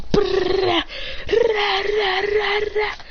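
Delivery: high trilled rapid-fire sound effect; rolled battle noise. Acted. Storyteller imitating the fighter's attack, rolled battle noise. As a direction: roll out a high "rah-rah" burst.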